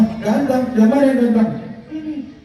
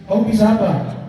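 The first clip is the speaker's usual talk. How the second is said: ordinary talk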